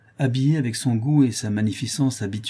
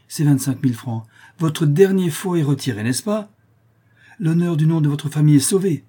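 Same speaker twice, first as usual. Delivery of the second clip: raised, higher-pitched cutting scorn; haughty, insolent reproach; 6 semitones higher, 5 dB louder than usual. A narrator's reading. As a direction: heap contempt on him and dismiss him. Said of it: "d'une voix brève et insolente".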